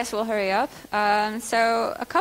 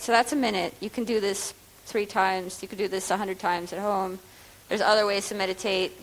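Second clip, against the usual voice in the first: usual speaking voice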